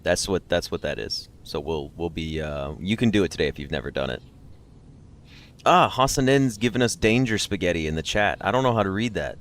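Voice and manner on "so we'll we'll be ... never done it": ordinary talk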